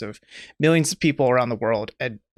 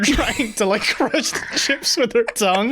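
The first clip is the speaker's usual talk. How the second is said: laughing; 7 semitones higher than usual. Real, not acted. Laughing while describing.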